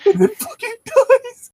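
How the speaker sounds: squeaky high laughing repeat of the punchline; laughing echo. Real, not acted. Echoing her friend's punchline through high laughter.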